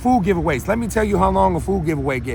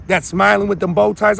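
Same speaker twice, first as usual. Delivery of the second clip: normal talking voice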